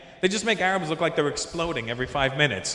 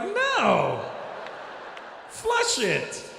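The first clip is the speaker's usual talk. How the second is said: high, drawn-out mock panic; mock horrified refusal; 13 semitones higher, much slower than usual. Comic refusing the woman's offer, mock horrified.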